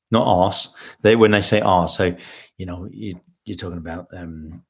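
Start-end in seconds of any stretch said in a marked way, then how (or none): 2.6-4.6 deep, quiet American accent with attempted R's; low, hesitant imitation American. Shown as a demonstration. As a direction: put on a hushed American accent, working on the R's. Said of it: "English people have if they try and do an American accent".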